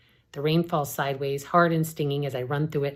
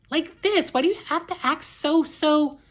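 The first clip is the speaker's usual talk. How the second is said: upset; 13 semitones higher, 4 dB louder than usual. Acted. Upset sister.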